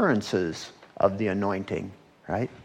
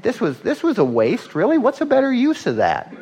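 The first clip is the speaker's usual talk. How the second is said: high, incredulous objection, rising louder; mock indignant; 12 semitones higher, 4 dB louder than usual. Preacher voicing critics of the costly gift, indignant. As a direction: protest the waste like an offended critic. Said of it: "some indignant".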